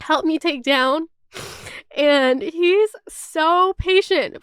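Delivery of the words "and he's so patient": slow, tearful, voice cracking with emotion; choking up while praising him; voice breaking. Real, not acted. Praising her patient boyfriend, voice breaking into tears. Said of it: "Sorry, I got kind of, like, emotional".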